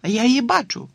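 high excited call; excited cry. Acted. Girl spotting the dog through binoculars, high excited cry. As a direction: shout it out spotting her. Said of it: "крикнула Тіна".

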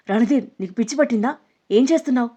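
high, loud outraged yell; furious shout. A narrator's reading. Heroine screaming at Randheer as he pulls her scarf, furious. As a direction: scream at him in fury. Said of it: "గట్టిగా అరిచింది ఆగ్రహంతో".

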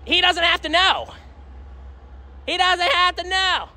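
high, drawn-out wheedle; coaxing flirt. Real, not acted. Streamer flirting with a passerby on Valentine's, high coaxing.

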